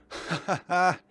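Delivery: loud, high laugh of delight; triumphant laugh. Real, not acted. Player delighted at opening the lock, triumphant laugh.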